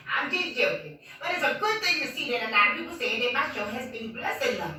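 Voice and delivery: ordinary talk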